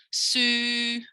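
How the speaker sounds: "Sue" held long on a level note; teacher's sustained "Sue"; model syllable. Shown as a demonstration. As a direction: draw out "Sue" clearly for copying. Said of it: "I'm gonna say it and you imitate me".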